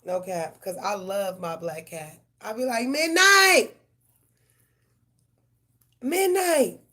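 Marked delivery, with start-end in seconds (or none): 2.9-6.8 loud, high yell across the yard; calling the cat. Retold or imitated. Owner calling her cat home, loud high yell. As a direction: holler the cat's name from outside. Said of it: "I be calling my cat".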